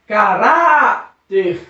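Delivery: "Caráter" with a long, drawn-out RÁ; stressed syllable stretched. Shown as a demonstration. Teacher showing the strong syllable, "Caráter" slow with held RÁ. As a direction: slowly stretch the middle of "Caráter". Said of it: "a sílaba mais forte é ra".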